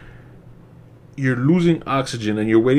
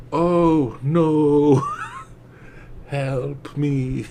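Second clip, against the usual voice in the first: limp, unbothered help me; mock calm cry for help; much slower than usual. Mocking the actress caught in the shark's mouth, flat and calm. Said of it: "So freaking calm".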